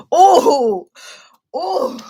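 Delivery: sharp straining cries; effortful cry. Real, not acted. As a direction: cry out while tugging hard. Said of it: "I'm winded from that".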